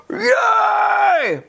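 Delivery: high shouted yay; crowd cheer. Retold or imitated. Coach mimics a stadium crowd with a high cheering shout. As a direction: yell it like a fan in the stands.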